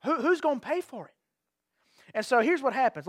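ordinary talk

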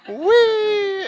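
high, playful "wee"; parent pushing swing. Retold or imitated. Parent pushing a kid on the swings, high playful wee. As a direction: sing it like pushing a toddler. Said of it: "they're like".

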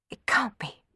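quiet gasp of disbelief; startled. A narrator's reading. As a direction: think aloud in soft shock. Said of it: "she thought, startled".

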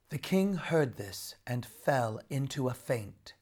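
usual speaking voice

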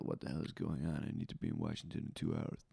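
low, hushed, hurried line; awful accent attempt, imitated. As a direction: mumble the line low and fast, mocking it. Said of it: "It sounded awful".

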